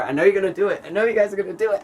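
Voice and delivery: playful mock wail at the viewers; teasing, building up. Real, not acted. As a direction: tease them, climbing up in pitch.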